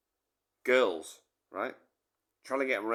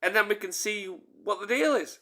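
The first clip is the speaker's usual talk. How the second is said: defiant challenge; 6 semitones higher, 5 dB louder than usual. Retold or imitated. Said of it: "I said".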